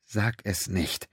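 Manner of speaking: silent plea. A narrator's reading. Pennington silently wills Quinn not to say it, hushed. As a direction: beg silently, barely voiced. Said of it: "Er wünschte sich mit aller Macht".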